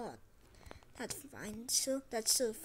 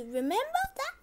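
changing voice; 13 semitones higher than usual. Retold or imitated. Youtuber showing viewers how their voice keeps changing, high pitch jump. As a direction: voice leaping way up high. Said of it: "it just keeps changing all over the place".

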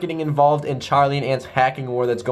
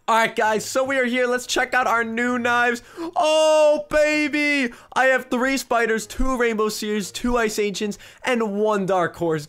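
high, drawn-out excitement; gleeful showing-off; 8 semitones higher than usual. Real, not acted. Prankster showing viewers the new knives, gleeful. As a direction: savour the haul, pitch up high.

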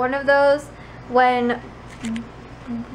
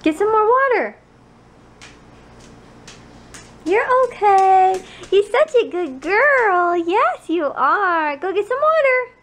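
higher, slow, sing-song praise; high praise for dog; 7 semitones higher, 4 dB louder, slower than usual. Real, not acted. Owner praising her dog for drinking, in high slow baby talk.

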